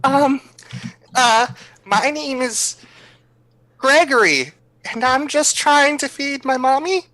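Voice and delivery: put-on high-pitched, hesitant child voice; innocent little kid. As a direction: play the golly-mister urchin, high and halting. Player voices a child to stranger.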